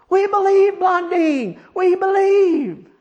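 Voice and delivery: high, drawn-out mock crowd yell. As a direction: holler it like a fervent crowd. Crowd answers showman's challenge, acted.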